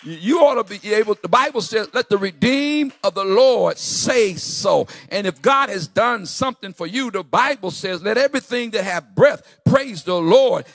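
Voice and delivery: loud, fervent sermon delivery; full-force preaching. Real, not acted. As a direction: preach it out to rouse the congregation.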